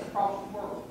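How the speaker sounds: usual speaking voice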